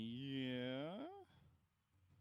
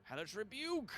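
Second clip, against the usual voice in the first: excited exclamation; 10 semitones higher than usual. Real, not acted. GM hypes the spell.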